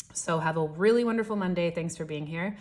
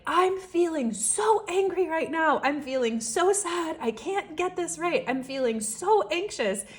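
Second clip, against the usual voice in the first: high, loud impassioned outburst of feelings; a father venting his upset aloud, not aimed at his kid; retold yelling; 10 semitones higher, 3 dB louder than usual. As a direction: yell out each feeling, voice pitched way up. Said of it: "but he starts yelling, saying things like".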